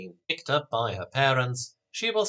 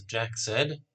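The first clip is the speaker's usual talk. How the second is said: usual speaking voice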